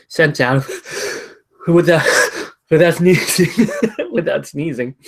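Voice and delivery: laughing through the words. Real, not acted. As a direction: giggle while trying to talk. Laughing while fighting a sneeze.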